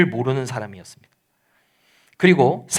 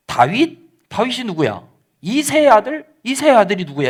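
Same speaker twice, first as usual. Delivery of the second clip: higher-pitched, incredulous questions, acted out; dismissive retort; 5 semitones higher than usual. Nabal rebuffing David's messengers, mock-incredulous. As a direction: toss each name back as a scornful question. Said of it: "나발이 어떻게 반응을 하냐면".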